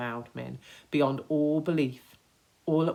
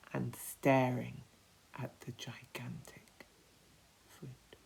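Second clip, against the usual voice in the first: hushed suspense; 3 semitones lower, 8 dB quieter, slower than usual. A narrator's reading. Narrator drawing out suspense.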